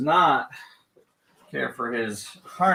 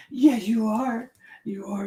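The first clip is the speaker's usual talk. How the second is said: higher, drawn-out doting praise; slow gushing praise; 12 semitones higher, slower than usual. Real, not acted. Trainer praising her dog in a high, slow, doting coo. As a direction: stretch it out lovingly for the dog.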